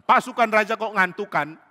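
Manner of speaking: mock them from a distance; taunting call. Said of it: "tiba-tiba ada suara mengatakan".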